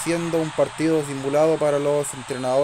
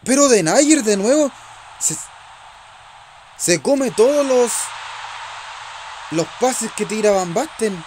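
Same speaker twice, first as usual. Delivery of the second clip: high, loud frustrated outburst; exasperated complaint; 6 semitones higher, 3 dB louder than usual. Real, not acted. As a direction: rant at the defender's blunder. Frustrated at defender's error.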